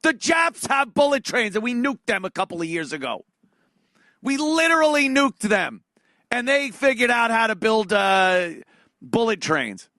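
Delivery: high, loud exasperated rant. Real, not acted. Comedian ranting to the audience about bullet trains, loud and high. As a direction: shout the outrage.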